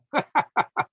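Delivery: short, high-pitched laugh; scornful laugh. Real, not acted. Host laughing at a badly written article, scornful. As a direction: burst out laughing, high and brief.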